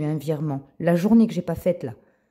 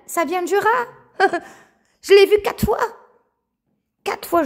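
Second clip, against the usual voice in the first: high, loud laughing disbelief; laughing amazement; 5 dB louder than usual. Real, not acted. Revealing the plague comes from rats, high laughing amazement.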